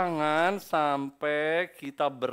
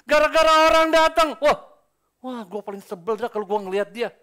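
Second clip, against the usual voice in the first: loud, higher, fed-up groan of annoyance; exasperated outburst; 8 semitones higher, 14 dB louder than usual. Retold or imitated. Preacher acting out a churchgoer who dreads a rival, exasperated loud. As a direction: blurt out how much you can't stand them. Acting out resentment at someone arriving.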